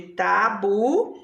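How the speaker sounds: tabuleiro slowly split, ta, bu, lei, ro; syllables separated. Shown as a demonstration. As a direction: stretch every syllable of tabuleiro. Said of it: "São quatro sílabas".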